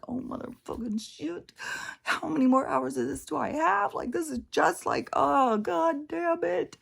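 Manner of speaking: high-pitched mock-whiny moaning; exaggerated whine of self-pity. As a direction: act out a whiny grumble, drawing out the final curse. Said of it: "I was being real whiny".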